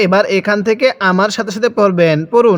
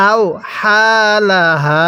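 very slow, drawn-out syllables; model recitation; much slower than usual. Shown as a demonstration. Teacher models Quran words for learners, slow measured recitation. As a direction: stretch each long vowel evenly. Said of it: "আমার সাথে পড়ুন".

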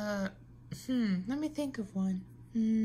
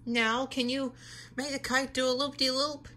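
normal talking voice

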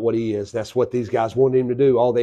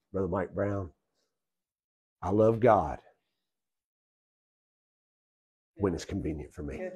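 1 mock lukewarm believer; 5 semitones lower, 9 dB quieter than usual.

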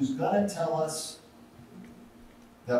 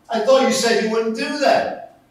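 angry shout; 9 semitones higher, 10 dB louder than usual. Retold or imitated. Waiter angry at splashed customer.

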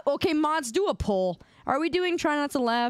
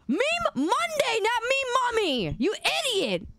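mock angry shout; 12 semitones higher than usual. Streamer fake-raging at chat over meme mommy. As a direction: stretch out the correction in fake rage.

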